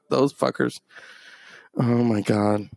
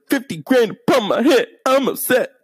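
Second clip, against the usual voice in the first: high, singsong mocking rap; exaggerated high-pitched rapper mimicry; 11 semitones higher, 4 dB louder than usual. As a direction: whine it like a pouty rapper, pitched up. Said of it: "And [name]'s just like".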